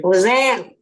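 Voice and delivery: put-on loud, playful New Orleans accent; New Orleans slang voice.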